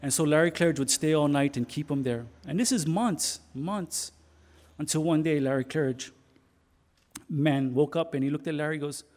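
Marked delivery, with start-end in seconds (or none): none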